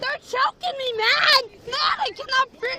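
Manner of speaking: loud, panicked, gasping cries; desperate yelling. Real, not acted. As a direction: shout that you can't breathe. Woman restrained, crying for help.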